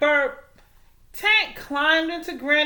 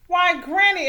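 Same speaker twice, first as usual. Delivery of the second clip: exclaiming; 6 semitones higher, 5 dB louder than usual. A narrator's reading. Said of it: "exclaimed Little Red".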